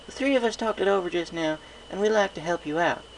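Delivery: high little-sister voice, coaxing; a small filly asking big sis a favour; young filly. A narrator's reading. Filly voice continues.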